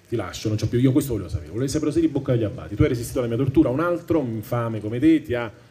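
ordinary talk, nothing marked

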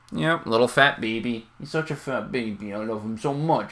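soft cooing over pet; overwhelmed by cuteness. Real, not acted. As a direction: coo softly at the lizard. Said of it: "That's that cute aggression again".

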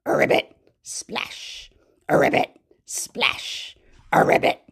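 whispered ribbit; croaking frog. Pretend. Teacher voices the splashing frog. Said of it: "Frog says".

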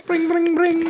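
high, mock phone ring; phone ring imitation. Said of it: "a meat phone".

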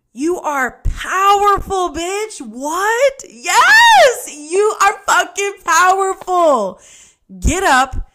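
high, loud, drawn-out cheering; ecstatic hype. Acted. Host cheering listeners at the mirror, ecstatic hype. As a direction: shout it up into a squeal of empowerment.

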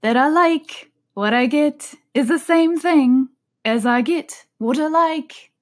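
high, odd hare voice; March Hare voice. Acted. The March Hare adding his own example, high and slow. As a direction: pitch up and slow at the end.

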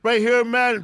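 high, loud host announcement; hyped-up podcast intro; guest introduction. Real, not acted. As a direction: present the guest to the crowd.

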